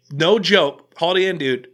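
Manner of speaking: ordinary delivery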